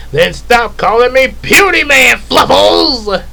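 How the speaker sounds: villain bristling at the nickname; indignant villain. Acted. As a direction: snap back at being called puny. Villain angrily objects.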